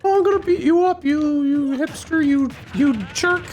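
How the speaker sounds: very high, halting mock-tough voice; Scott Pilgrim. Lovesick hero threatening Andros over his girlfriend, high-pitched impression. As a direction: squeak out feeble threats in a put-on movie voice. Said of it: "Scott Pilgrim voice, yeah".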